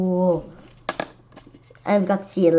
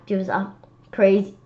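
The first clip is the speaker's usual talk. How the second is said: ordinary delivery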